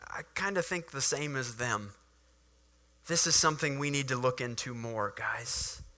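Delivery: softly voiced cautious Nicodemus; soft, careful suggestion; quiet, hesitant. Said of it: "and says".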